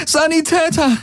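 high, urgent call for a medic. Acted. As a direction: call across the battlefield, high. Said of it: "rief sie den wenigen Golvonen zu".

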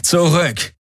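high-pitched order; sharp command. A narrator's reading. Guard leader Tari orders intruders back, sharp command. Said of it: "befahl Tari".

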